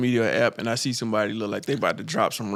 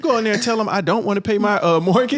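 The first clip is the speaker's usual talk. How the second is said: pitched-up, eager mock tip; hyped advice-giver; 9 semitones higher, 5 dB louder than usual. Online tipster on skipping mortgage payments, mock hyped voice. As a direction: imitate an excited online tipster.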